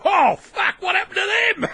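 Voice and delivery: high, loud mock dismay; high shocked shout. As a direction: yelp it in fake shock. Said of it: "people would say".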